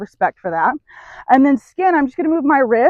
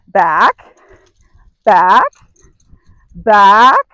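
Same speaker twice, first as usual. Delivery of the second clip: firm, louder repeated back; firm cue; 3 semitones lower, 6 dB louder, much slower than usual. Shown as a demonstration. Trainer to horse, a firm louder back cue. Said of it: "I'm gonna say".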